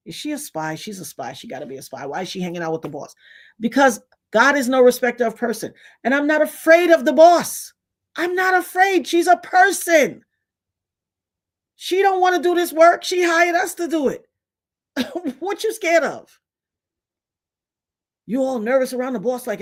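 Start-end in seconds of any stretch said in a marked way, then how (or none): 0.1-3.2 low, hushed coworker murmur; hushed gossip. Retold or imitated. Coworkers gossiping as they pass by. 6.0-16.4 high-pitched, emphatic protest; defiant exclaiming. Real, not acted. Defiant rant about fearing the boss.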